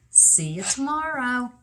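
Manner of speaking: ordinary delivery, nothing marked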